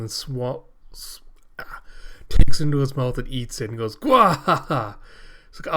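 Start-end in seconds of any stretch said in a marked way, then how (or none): 4.0-5.0 loud, slow booming villain cackle; evil villain laugh. Acted. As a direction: laugh like a gloating monster. Villain Makaku laughs after eating.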